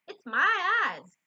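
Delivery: loud, high, slow Southern wail; Southern accent. A narrator's reading. Mama blaming her eyesight, loud Southern accent. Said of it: "just gonna go with a Southern one".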